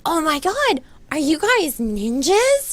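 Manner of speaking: put-on high, drawn-out gushing girl; excited girl impression. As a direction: imitate a starstruck girl, high and slow. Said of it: "I'm doing, like, a, almost an accurate".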